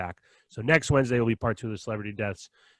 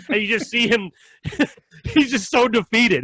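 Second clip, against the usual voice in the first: laughing while telling; 10 semitones higher, 4 dB louder than usual. Real, not acted.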